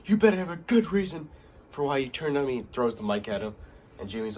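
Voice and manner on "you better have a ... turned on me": sharp, higher, louder accusing demand; angry confrontation. Retold or imitated. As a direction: confront a brother who betrayed you. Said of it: "Jey gets mad".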